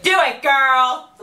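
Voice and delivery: high, drawn-out cheering yell; retold yell. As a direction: holler encouragement, stretching the words. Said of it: "yell at her".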